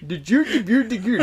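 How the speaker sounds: high, loud fake-Swedish gibberish; hammed-up Swedish nonsense, laughing; mock Swedish accent. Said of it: "He's not even Swedish".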